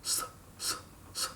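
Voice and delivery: "S" as a long voiceless hiss; unvoiced hiss. Shown as a demonstration. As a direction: let "s" hiss out with no voice. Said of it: "This is not needed".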